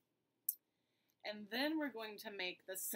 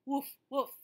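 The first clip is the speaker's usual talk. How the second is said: louder barking woofs; dog woof; 5 semitones higher, 8 dB louder than usual. Shown as a demonstration. Teacher showing children the dog sound, loud barking. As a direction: bark it out like a dog. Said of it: "the dog says".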